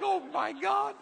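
high, loud, hammed-up exclamation; mock shock. Preacher overwhelmed by a stylist's confession, mock shock. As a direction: cry out in exaggerated disbelief. Overwhelmed reaction to hearing her sins.

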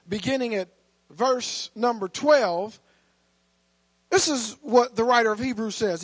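ordinary delivery

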